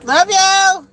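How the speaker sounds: loud, high sing-out goodbye; affectionate holler, pitched well up; called-out goodbye. Real, not acted. Calling goodbye to departing child.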